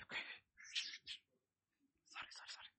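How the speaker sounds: whispered, barely voiced backing down; hushed, voiceless acted contrition; whispered apology. Acting David backing down before God.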